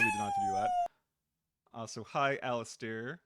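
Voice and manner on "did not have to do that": fast high squeal. Real, not acted.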